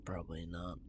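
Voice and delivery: barely audible, low whisper; whispered aside. Real, not acted. Player answering a doubt about drinking that, whispered. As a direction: whisper it under your breath.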